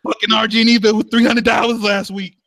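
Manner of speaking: high-pitched mock dad rant; hammed-up parental complaint; yelling dad. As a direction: gripe like a dad over the bills. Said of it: "that's why he's yelling at you".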